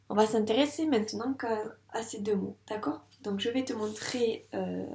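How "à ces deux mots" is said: ordinary talk, nothing marked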